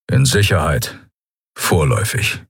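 low, terse creature voice; gruff beast-man. A narrator's reading. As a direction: answer low and curt. (tiefe, knappe Stimme des Tierkriegers)

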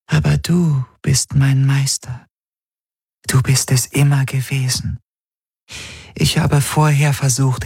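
a loyal spirit asking her master's forgiveness; devoted spirit. A narrator's reading. Voices the glass spirit.

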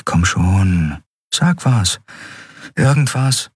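lightly voiced, impatient urging; Andriel. A narrator's reading.

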